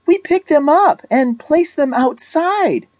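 high-pitched, distressed objection; wailed reproach, pitched far up; upset protest. A narrator's reading. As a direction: plead on behalf of the crushed spider. Anne protests the spider killing.

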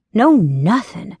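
usual speaking voice

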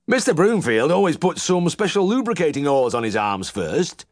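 high twang, pleased with himself; contented old farmer. A narrator's reading. Uncle needling the vet about his methods, high twang. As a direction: smugly pass on the tip. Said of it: "Uncle said, pulling contentedly on his pipe".

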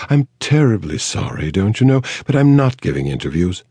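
a society lady refusing, politely; Mayfair accent. A narrator's reading. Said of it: "the Mayfair accent".

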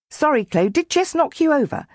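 put-on high, breezy boy's sorry; tossed-off sorry from a grinning brother; cheeky brother. A narrator's reading. Brother apologising to his sister for the dog, breezy and high.

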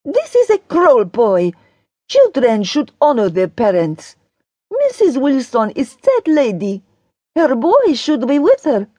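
high, stern Daria voice; stern pronouncement in a high character voice; severe. A narrator's reading. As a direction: scold severely, pitched high. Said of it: "Daria is saying severely".

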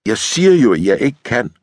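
fed-up, full-voiced insistence; exasperated protest. A narrator's reading. Woman refuses lieutenant's advances, exasperated.

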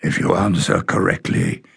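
lightly voiced stern guardian laying out terms; rasping guardian. A narrator's reading. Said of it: "in a low, rasping voice".

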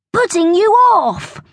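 put-on high, protesting boy's voice; Bertie, indignant schoolboy. A narrator's reading. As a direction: explain it indignantly, pitched well up.

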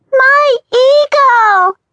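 put-on high, loud, drawn-out toddler shout. Toddler shouting for her brother, high slow and loud. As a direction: bellow it like a stubborn little child. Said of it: "the child bellowed".

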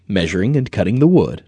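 ordinary delivery, nothing marked

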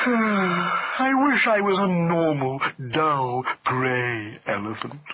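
very slow, plaintive elephant voice; ponderous, self-pitying wail; mournful elephant. A narrator's reading. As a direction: lament every word at length. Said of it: "said Hamlet mournfully".

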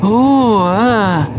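high startled oh then hesitation; a little girl unsettled by the dark; uneasy. A narrator's reading. Tunnel darkness scares Topsy.

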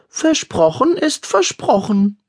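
put-on slow, high boy's voice, chewing; boy at the table chewing, unbothered; casual, chewing. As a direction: toss it off lazily through a snack. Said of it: "warf Finn lässig kauend".

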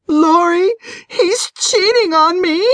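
high, tearful wail of betrayal. A narrator's reading. Wife phones her friend about her cheating husband, wailing. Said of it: "she wailed".

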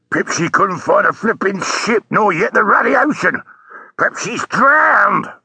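high-pitched, exasperated cabbie outburst; in a passion. A narrator's reading. Taxi driver retorting to policeman about his fare, in a passion. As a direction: throw the suggestion back, pitch climbing angrily. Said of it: "said the taxi driver in a passion".

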